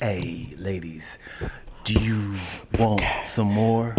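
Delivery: slow, low, hushed come-on; slow R&B seduction; nice and sexy. Acted. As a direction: sweet-talk the ladies softly, taking your time. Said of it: "Do it, do it nice and sexy".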